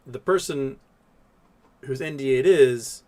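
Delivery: normal talking voice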